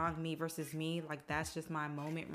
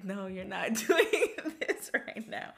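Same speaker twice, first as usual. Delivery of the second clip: high, loud protest through laughter; laughing; 4 semitones higher, 8 dB louder than usual. Retold or imitated. Telling boyfriend to stop hyping her, retold laughing. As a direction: crack up while retelling your own protest.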